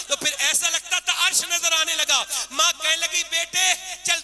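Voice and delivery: preaching at full force. Acted. Preacher bringing the story to its climax, building to a shout. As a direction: drive the climax home, rising to a cry.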